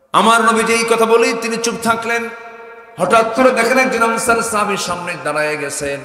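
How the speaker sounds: loud, high-pitched narration to a crowd; full-force preaching. Real, not acted. Preacher telling of the helper's arrival, loud impassioned preaching. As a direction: proclaim each phrase at full power.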